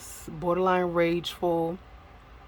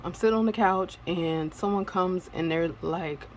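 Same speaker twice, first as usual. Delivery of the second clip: ordinary talk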